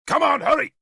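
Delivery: sharp high shout; snapping. A narrator's reading. King snapping at his guard, shouting. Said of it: "he snapped at the nearest guard".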